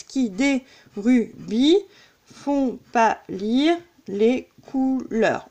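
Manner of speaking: slow scansion, syllable by syllable; measured, deliberate verse count. Shown as a demonstration. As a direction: separate each beat of the verse. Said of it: "on met un trait entre les deux consonnes".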